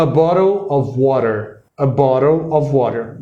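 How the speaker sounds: American accent, flapped t in water. Shown as a demonstration. English teacher demoing the American accent to the host. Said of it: "o americano fala".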